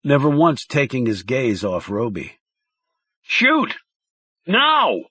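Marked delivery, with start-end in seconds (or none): 3.2-5.0 high, frantic yelled order; a panicked handler losing control; shrill hysterical demand. A narrator's reading. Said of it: "The man sounded hysterical".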